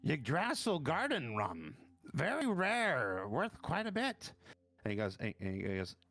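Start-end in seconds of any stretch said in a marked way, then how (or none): 0.1-4.3 higher, slow and savouring; drawn-out connoisseur admiring a rare rum; rising high and slow as he admires the bottle; appraising officer voice. Acted. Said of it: "he looks at it and goes".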